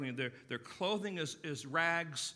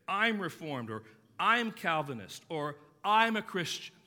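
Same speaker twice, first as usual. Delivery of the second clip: louder, proud label-claiming; hammed-up boast of belonging; mock proud declarations; 4 semitones higher, 9 dB louder than usual. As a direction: announce each label like a badge, mildly mocking. Preacher mimics shallow creed claims.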